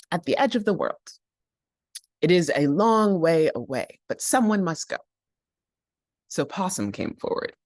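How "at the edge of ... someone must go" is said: urgent. Acted. Raven urges.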